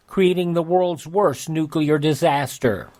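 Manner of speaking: ordinary talk, nothing marked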